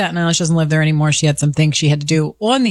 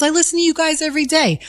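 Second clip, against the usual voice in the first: excited fan; 10 semitones higher than usual. Retold or imitated. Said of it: "He goes".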